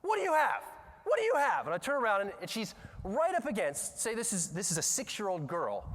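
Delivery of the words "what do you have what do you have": high, loud mock interrogation shout; imitated teacher's demand. Teacher confronting a six-year-old girl, loud imitated demand. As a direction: yell it like an accusing teacher. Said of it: "this noise from the teacher".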